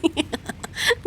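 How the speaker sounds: airy giggle up high; helpless wheezing laugh; soft unvoiced giggle; high breathless laugh. Real, not acted. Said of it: "मुझे इतनी हंसी आई".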